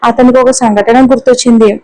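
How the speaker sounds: usual speaking voice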